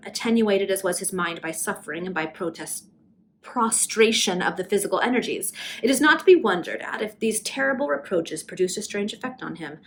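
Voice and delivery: ordinary delivery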